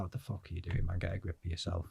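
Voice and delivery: hushed self-scolding to get a grip; inner voice. Retold or imitated. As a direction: quietly tell yourself to get a grip. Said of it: "And I was like".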